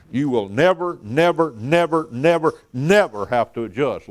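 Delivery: imitating an insistent NEVER, repeated in punchy beats. Instructor assures his class about the machine, insistent repeated NEVER. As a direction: drum out each NEVER like a sure instructor.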